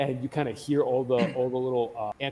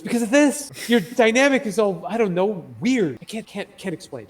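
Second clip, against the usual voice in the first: high, loud and flustered; blurting it out, lost for words; flustered exclamation; 7 semitones higher, 7 dB louder than usual. Acted. Priestess flustered by the party's odd dynamic, high and loud. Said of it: "and she goes".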